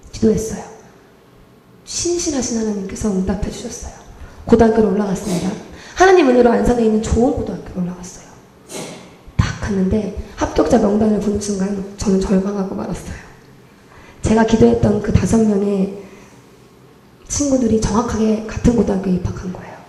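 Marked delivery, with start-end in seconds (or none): none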